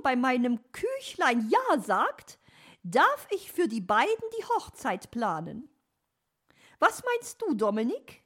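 loud, very high bird-lady voice; confident magpie. Acted. Magpie sets the wedding wager, high confident voice. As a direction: seal the bet in a high magpie voice.

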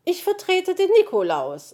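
high, loud, self-important announcement; proud. A narrator's reading. Magpie announces her role as Nikolaus's stand-in, proudly. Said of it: "erklärte die Elster stolz".

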